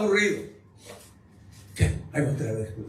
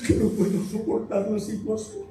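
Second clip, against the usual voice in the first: higher-pitched mock weepy voice; mock tearful; 3 semitones higher than usual. Preacher imitating people who cry easily, mock tearful. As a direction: act out someone about to cry. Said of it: "Y la persona llora".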